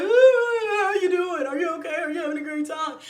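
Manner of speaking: high, loud "ooh" sliding down into rushed questions; put-on airy, gushing check-in; imitated party-goers. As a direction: mimic oblivious revelers fussing breezily. Mimics everybody breezily checking in.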